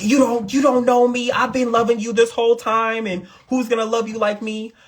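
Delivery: mock-tearful accusation; hammed-up crying tirade. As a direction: play an over-the-top hurt partner. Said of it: "Leo will start crying".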